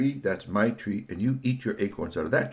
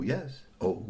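plain reading voice

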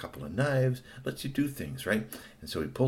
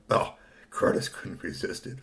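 usual speaking voice

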